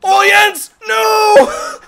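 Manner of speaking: high, very loud cry of dismay; dismayed shout. Real, not acted. Player watching Jens miss, dismayed yell. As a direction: wail it at your teammate. Crying out as play goes wrong.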